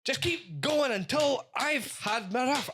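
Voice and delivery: a hammed-up eager child; the kids begging him to go again, eager child voice; demanding kid.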